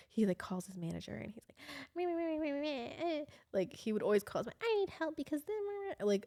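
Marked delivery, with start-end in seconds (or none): 2.0-3.3 high, soft mimicking "meh meh"; wordless high-pitched mockery of a complainer; mocking whiny babble. Woman mimics boyfriend calling his manager, mocking whine. 4.6-5.4 high, small helpless voice; mock whining. Woman imitates boyfriend calling his manager, mock whining. Mocking boyfriend's calls for help.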